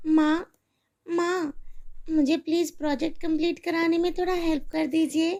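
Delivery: put-on a little girl begging her mother for help; pleading little girl. Narrator voices young daughter Neha.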